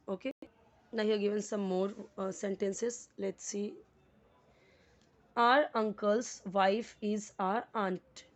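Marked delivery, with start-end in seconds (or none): 5.4-8.2 each word spaced out, very slow; slow dictation. Shown as a demonstration. Teacher reading a sentence aloud to the class, slow and deliberate. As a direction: read it word by word for students. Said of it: "teacher reads example sentence".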